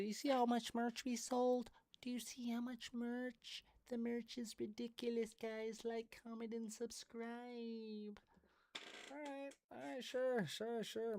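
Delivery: high, soft, giddy voice mimicking her; squeaky mock of an excited influencer; mocking impression of her. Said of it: "she said".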